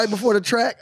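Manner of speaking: ordinary talk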